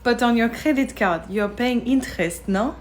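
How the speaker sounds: put-on French accent with a questioning no at the end. Frenchwoman questioning tourist about interest, French accent. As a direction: gently challenge her in a French accent. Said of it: "a very impressive French accent".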